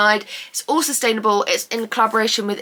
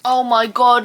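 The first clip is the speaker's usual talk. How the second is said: ordinary talk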